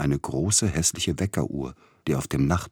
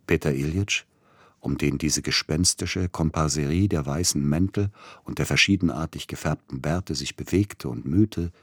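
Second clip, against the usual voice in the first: usual speaking voice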